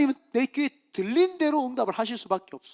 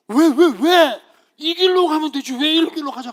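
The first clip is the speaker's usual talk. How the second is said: high, loud mock outrage; acted indignant "why" outburst; angry protest; 7 semitones higher, 9 dB louder than usual. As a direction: complain like an angry crowd, much louder. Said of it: "이스라엘 백성이 또 화가 났어".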